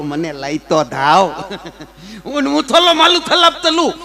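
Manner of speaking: "થલો માલું થલાપ તલું" with th and l for s and r; lisping suitor Magan. Retold or imitated. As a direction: lisp like a small child. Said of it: "તોતડી હોય ને".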